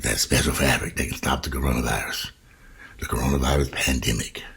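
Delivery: a sly whispered aside. Real, not acted. Whispering to viewers about the magic fabric. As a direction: drop into a whisper as if sharing a secret. Mocks special mask fabric.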